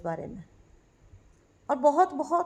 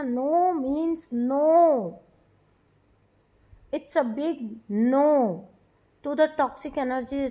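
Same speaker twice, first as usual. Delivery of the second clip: very slow, each word set apart, louder; firm slow no; 3 dB louder, much slower than usual. Acted. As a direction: lay down a firm, unbending no. Firmly refusing toxic people. (बहुत धीरे, ज़ोर देकर "नहीं")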